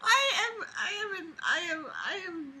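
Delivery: high broken laughter through words; laugh-crying. Real, not acted. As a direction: stammer while laughing helplessly.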